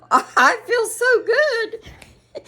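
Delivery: very high, slow words through laughter; laughing spell. Retold or imitated. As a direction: reenact giddy helpless giggling. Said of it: "that laughing spell".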